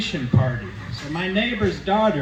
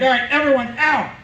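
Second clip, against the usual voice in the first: higher, louder mock-angry command; angry order; 7 semitones higher, 3 dB louder than usual. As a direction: throw the whole party out. Dad orders guests out.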